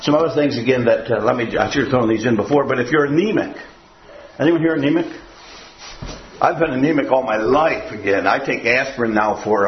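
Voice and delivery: normal talking voice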